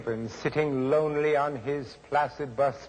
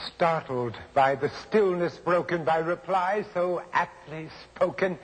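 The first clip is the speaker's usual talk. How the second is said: usual speaking voice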